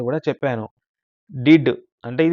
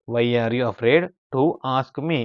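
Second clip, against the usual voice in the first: slow model reading; much slower than usual. Shown as a demonstration. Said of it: "teacher models English sentence slowly".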